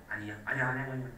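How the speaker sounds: soft, low mock denial; a lightly acted inner denial, half under the breath; denying it. Shown as a demonstration. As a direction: brush it off, quietly in denial. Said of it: "이렇게 할 거라고".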